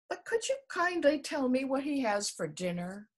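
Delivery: high, polite little voice; polite elephant's child. A narrator's reading. The elephant's child asks the snake, high and polite.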